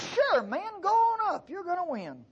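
high, breezy mock assurance; sarcastic. Prophet mocking the king, high sarcastic go-ahead. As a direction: toss it off with facetious high cheer. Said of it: "sarcastic. ... facetious".

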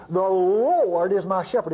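"Lord" drawn long in a slow reading; stress on LORD. Shown as a demonstration. Preacher showing listeners the all-caps word in the psalm, LORD drawn out. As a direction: stretch out the word "Lord", then finish the verse.

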